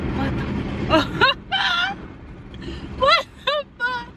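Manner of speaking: shocked disbelief. Retold or imitated. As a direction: act stunned at the sight, laughing mid-line. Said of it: "you gonna be like".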